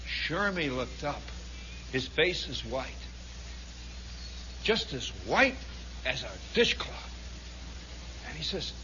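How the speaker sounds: very soft, low storytelling; low, quiet dramatic narration; hushed suspense. A narrator's reading. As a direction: let the tension hang with long pauses.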